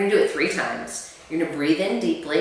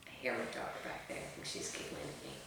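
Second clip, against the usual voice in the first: whispered, much softer aside; 5 semitones lower, 20 dB quieter than usual. Real, not acted. Mother whispering an aside about her giggling daughter. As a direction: lean away and whisper it. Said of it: "aside about daughter laughing".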